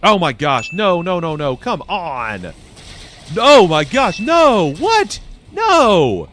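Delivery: loud, high protesting cries; real exasperated shouting; outraged disbelief. Real, not acted. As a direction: yell out in shocked dismay. Game suddenly goes wrong.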